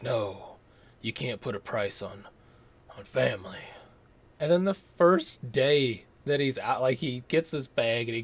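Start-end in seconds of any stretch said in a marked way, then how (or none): 0.0-3.6 lower, softer mock gravity; a hint of brooding Seagal refusing money; solemn Seagal. Reviewer mocking Seagal's noble line, lower and softer. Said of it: "he's like".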